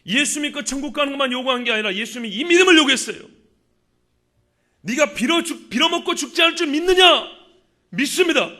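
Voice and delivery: forceful sermon delivery with emphatic quotations; impassioned preaching. Acted. Preacher quotes Jesus urging the congregation to believe, impassioned preaching. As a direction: drive the faith message home to the congregation.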